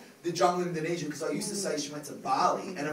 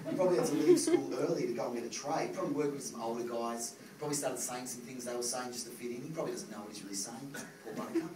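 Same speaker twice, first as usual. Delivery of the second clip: calm understanding; 5 semitones lower, 7 dB quieter, faster than usual. Retold or imitated. Calm reaction to a racist.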